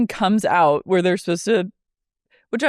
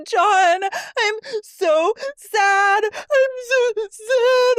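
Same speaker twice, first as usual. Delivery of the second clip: much higher, slow fake crying; mock sobbing Lisa; much slower than usual. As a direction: wail it like a weeping Lisa. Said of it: "She goes".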